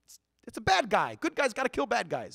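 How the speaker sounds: put-on high, whiny boy's protest; David protesting to his big brother, high and pleading; defensive kid David. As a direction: squeak it out like a kid told off. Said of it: "David goes".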